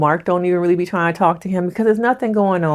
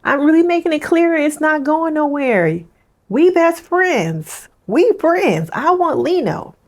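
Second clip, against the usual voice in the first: high mock girlfriend voice spelling it out; friend-zoning him in a high teasing voice; mocking her; 10 semitones higher than usual. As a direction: lay it on thick, much higher. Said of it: "the emphasis of always saying".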